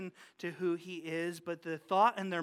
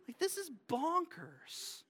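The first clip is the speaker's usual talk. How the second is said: disbelief; 9 semitones higher, 3 dB quieter, slower than usual. Real, not acted. Disbelief at roof digging.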